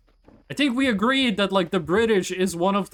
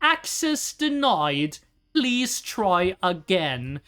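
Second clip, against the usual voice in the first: put-on posh British accent; much slower than usual. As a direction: stretch Access denied out in a posh British accent. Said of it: "in this exact accent".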